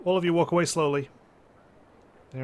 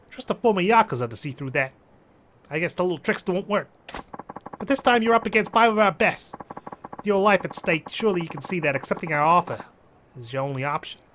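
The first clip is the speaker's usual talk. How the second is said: higher, pushy Jersey mobster; Jersey accent; 4 semitones higher, 3 dB louder than usual. Acted. Mobster threatening a former yakuza, Jersey accent. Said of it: "Japanese mafia member has a Jersey accent".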